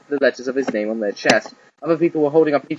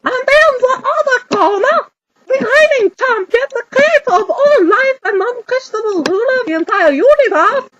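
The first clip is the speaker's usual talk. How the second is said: stadium announcer; 5 dB louder than usual. Acted. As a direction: open the show to the crowd.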